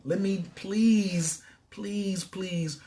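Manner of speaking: slow drawn-out begging please; pleading. Acted. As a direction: beg them, slowly, fading. Begs listeners to leave suffering.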